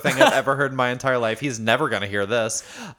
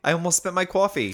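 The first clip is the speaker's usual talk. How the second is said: higher-pitched mimicked East Coast accent; 6 semitones higher than usual. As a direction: quote her with East Coast "cwaw-fee". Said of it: "She says coffee".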